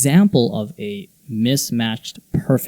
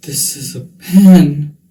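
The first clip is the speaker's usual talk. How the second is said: slow, with PEN loud and drawn out; stretched, heavy PEN; emphatic intonation; 2 semitones higher, 8 dB louder, slower than usual. Shown as a demonstration. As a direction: lean hard and long on PEN. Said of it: "some examples that I thought were fun".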